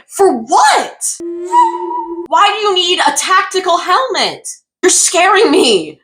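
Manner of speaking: high, slow mock-frightened questions; mock alarm. Real, not acted. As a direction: act spooked by the helmet. Alarmed at tactical helmet.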